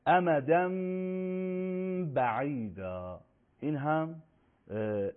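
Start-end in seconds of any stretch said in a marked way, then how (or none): 0.1-3.2 «امدن بعیدا» with the final n hummed as a long m; hidden mim. Shown as a demonstration. Teacher giving an ikhfa example, long nasal mim before ba.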